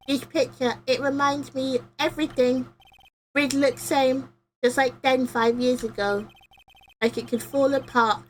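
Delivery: hint of a small kid remembering; lightly voiced child. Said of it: "the most kid-like voice I can".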